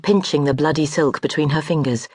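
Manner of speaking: usual speaking voice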